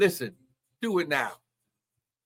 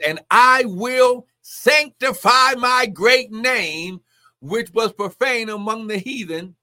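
loud, high, slow preacher's proclamation; proclaimed scripture; 6 semitones higher, 6 dB louder, slower than usual. Real, not acted. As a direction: declaim the verse at full force.

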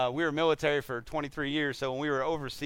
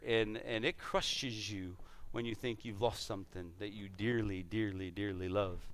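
hushed heartfelt; 7 semitones lower, 11 dB quieter than usual. Real, not acted. Preacher softens emotionally.

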